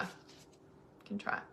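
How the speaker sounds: breathy slow whispered echo. Shown as a demonstration. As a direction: whisper it slowly. Whispered echo of own line.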